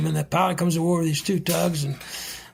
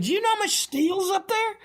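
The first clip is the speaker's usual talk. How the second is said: imitating the pilot, pitched much higher and incredulous; mock pilot voice. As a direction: parrot the pilot's disbelief in a high mock voice. Retold pilot lecturing the captain.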